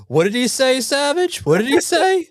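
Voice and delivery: high, playful mock-grandma voice; a teasing impression of Grammy demanding to know; imitating Grammy. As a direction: pitch way up and pester like Grammy. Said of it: "she's probably gonna say".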